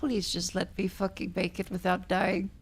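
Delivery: hushed frightened pleading; scared horror game streamer. Real, not acted. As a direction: beg under your breath, terrified.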